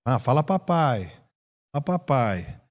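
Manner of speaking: lightly voiced father coaxing his baby; low, gentle repeated prompting. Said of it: "Aí o papai chega do serviço".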